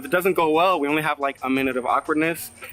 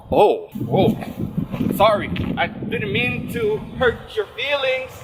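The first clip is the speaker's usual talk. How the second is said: flustered apology; 7 semitones higher, slower than usual. Retold or imitated. Retold flustered apology to crying girl.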